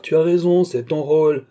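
put-on high, loud triumphant old man; gleeful. A narrator's reading. Father crowing over his son, high and loud. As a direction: crow it at him.

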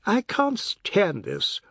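high, drawn-out exasperated cry; slow, high-pitched protest; shouting. A narrator's reading. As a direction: shout it at breaking point. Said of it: "Oad shouted".

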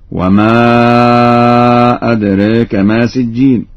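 أدراك as adreka, long a tilted toward e; taqlil. Shown as a demonstration. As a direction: chant it with long-held وما and e-coloured أدراك. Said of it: "بتقليل الألف ذات الياء وذات". (تلاوة بتقليل الألف في أدراك)

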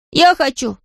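put-on eager high child's shout; high-pitched eager exclamation; crying out. A narrator's reading. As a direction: burst out like an excited small boy. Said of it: "закричал он".

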